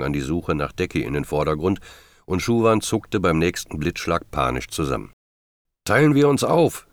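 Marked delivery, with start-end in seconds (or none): 5.8-6.9 raised, high-pitched storytelling shout; shouting against wind. A narrator's reading. John to the searchers against whipping wind, shouting. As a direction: call it out over a storm. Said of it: "schrie John gegen den peitschenden Wind an".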